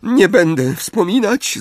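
high, tearful lament; sobbing. A narrator's reading. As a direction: sob it out. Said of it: "zaszlochała". (szlochając, wysoko)

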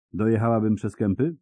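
ordinary delivery, nothing marked

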